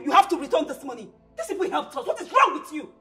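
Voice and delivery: angry wife shouting demands; angry pleading. Acted. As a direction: push him hard to give back the money. Wife pleads angrily with husband.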